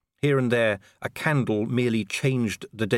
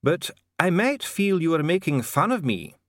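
higher-pitched, formal dwarf official; 7 semitones higher than usual. Acted. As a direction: speak as a high-voiced, formal dwarf functionary. Dee the dwarf official speaks.

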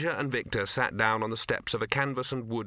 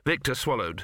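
ordinary delivery

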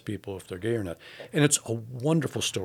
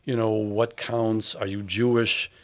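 ordinary talk, nothing marked

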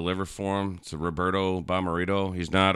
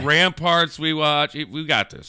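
mock cheering on; 7 semitones higher, slower than usual. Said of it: "I was like".

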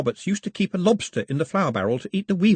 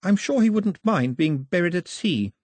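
all in one breath; 9 semitones higher than usual. A narrator's reading. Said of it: "all in one breath".

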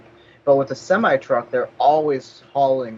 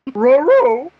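high, loud cartoon dog yelp; cartoon dog catchphrase cry; Scooby-Doo; 13 semitones higher, 10 dB louder than usual. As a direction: howl it like a goofy cartoon dog. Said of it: "Nice Scooby-Doo joke there".

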